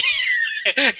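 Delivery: real high-pitched cackle of amusement; bursting laugh. Real, not acted. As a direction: crack up mid-story. Cracks up telling friends' shocked reaction.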